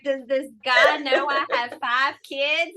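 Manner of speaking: asked through a laugh; amused, incredulous question; laughing disbelief. Retold or imitated. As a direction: laugh while wondering aloud. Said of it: "I was like".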